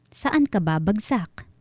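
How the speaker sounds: ordinary delivery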